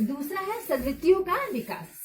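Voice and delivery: usual speaking voice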